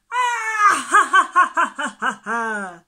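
high, loud cackling ha ha ha; loud laughter. A narrator's reading. The animals laughing at the worm, loud high laughter. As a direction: laugh hard at the silly name, then let it fade. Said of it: "laughed all the other animals".

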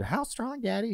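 put-on very high-pitched small child; squeaky childlike question to daddy; little kid voice. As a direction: imitate yourself as an awestruck little kid. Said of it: "that's exactly what my voice sounded like".

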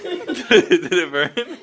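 slow, giggly check after a scare; laughing question. Real, not acted. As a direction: ask through real laughter. Amused at the friend's shriek.

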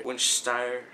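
"Winchester" garbled as "Winch-stire"; mispronunciation. Shown as a demonstration. Vlogger still failing to pronounce the card's word, mispronunciation. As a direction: say "Winchester" ending in a long "stire". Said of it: "No, that's still not right".

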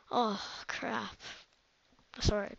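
ordinary talk, nothing marked

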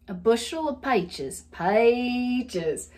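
Southern "peaches" as "pie-ches"; Southern accent. Shown as a demonstration. Savouring the country word, Southern accent. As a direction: relish the wide Southern vowel. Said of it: "heavy Southern accent".

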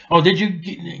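normal talking voice

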